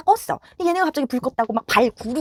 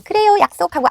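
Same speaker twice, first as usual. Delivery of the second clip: put-on a light, higher-pitched child's easy yes; mock child agreeing; 6 semitones higher, 6 dB louder than usual. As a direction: play the kid promising breezily.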